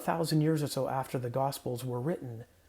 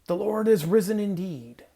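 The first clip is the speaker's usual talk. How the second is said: loud, higher call on "Lord is risen"; Easter proclamation; 5 semitones higher, 8 dB louder than usual. Shown as a demonstration. Pastor leading the Easter call and response, loud. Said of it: "in case that one also caught you by surprise".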